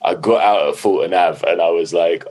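ordinary talk

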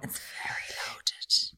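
drawn-out whispered aside. Real, not acted. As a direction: stretch it out in a breathy whisper. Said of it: "whispered again".

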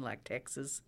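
soft Texas accent; George Bush. Shown as a demonstration. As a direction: put on a Texan twang, softly. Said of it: "like George Bush".

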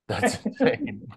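high-pitched amazed exclamation; surprised. Real, not acted. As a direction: squeal it in amazement. Repeats the phrase twice.

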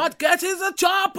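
loud high action-hero bark; Schwarzenegger shout. Retold or imitated. Mocking Arnold's movie line, loud shout.

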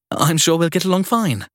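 bright, strained politeness; forced cheerfulness. Acted. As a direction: smile through the nerves. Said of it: "forced a smile".

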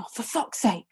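normal talking voice

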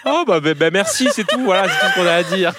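laughing through the words; words spilling out between laughs; crying with laughter. Real, not acted. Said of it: "[name] pleure de rire".

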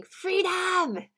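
put-on high, loud shouted "Freedom!" in a Scottish accent; Braveheart battle cry. Preacher imitating Mel Gibson rallying troops, loud shout. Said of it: "imagine Mel Gibson in Braveheart ... shouting".

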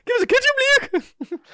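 put-on high, shouted stab at a Sunderland accent. Host attempting a footballer's accent on request, shouted Sunderland accent. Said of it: "only accent I could do".

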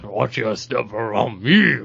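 slow, low alien crime-lord voice; Jabba. Acted. Asked to say it in a Jabba voice, hammed-up slow creature impression. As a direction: draw it out low and slow, swelling on the last word. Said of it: "can you do the Vader, or the Jabba voice".

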